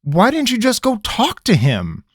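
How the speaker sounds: a frustrated demand. Acted. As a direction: push back, fed up.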